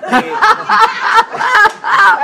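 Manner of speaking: high, loud cackling laugh; loud burst of laughter. Real, not acted. Telling friends her story, bursts into loud laughter. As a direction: let out a big, loud cackle.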